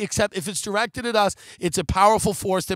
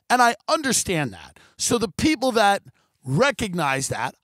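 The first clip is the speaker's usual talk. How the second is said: ordinary delivery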